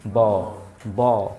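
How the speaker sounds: ordinary delivery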